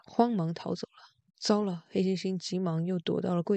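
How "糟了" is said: plain reading voice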